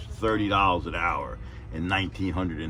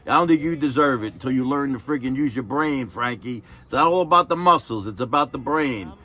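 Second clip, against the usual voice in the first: much higher, loud public telling-off; mock scolding boss; 7 semitones higher, 6 dB louder than usual. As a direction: roast him in front of everyone. Boss lecturing him.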